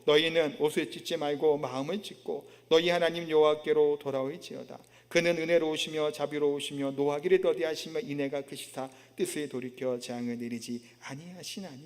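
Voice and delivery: low, level recited verse; scripture reading. Acted. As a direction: read the passage out solemnly in a low monotone. Reads scripture aloud.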